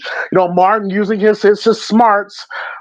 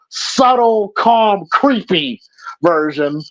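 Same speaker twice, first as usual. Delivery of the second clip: ordinary delivery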